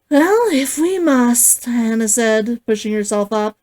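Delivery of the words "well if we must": reluctant. A narrator's reading. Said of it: "Hana wrinkled her nose".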